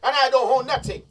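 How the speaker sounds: impassioned loud protest; heated. Real, not acted. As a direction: hammer it home with rising heat. Heated point about country's wealth.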